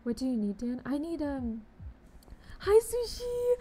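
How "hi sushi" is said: much higher, louder squeal of hello; high excited squeak; squealed greeting. Real, not acted. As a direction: squeal the name like you're thrilled to see her.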